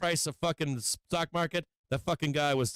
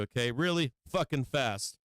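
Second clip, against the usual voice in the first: ordinary delivery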